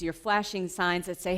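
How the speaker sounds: ordinary delivery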